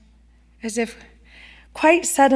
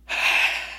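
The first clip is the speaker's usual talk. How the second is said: a quiet breathy sigh, letting the air go; soft sigh; 5 dB quieter than usual. Acted. Third woman sighing over her needlework, a soft unvoiced sigh. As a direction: sigh out the weariness. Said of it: "The third woman sighed".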